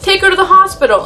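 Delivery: high-pitched imitation of an outraged aunt; indignant scolding. Aunt quarrels with uncle.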